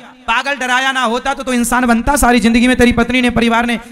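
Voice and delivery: loud, angry doctor's scolding; scolding shout. Acted. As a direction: tell him off at full volume. (Doctor का ज़ोरदार डाँटना)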